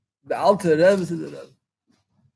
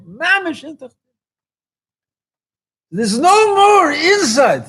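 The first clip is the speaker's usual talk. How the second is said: preaching exhortation; 6 semitones higher than usual. Real, not acted. Rising sermon exhortation.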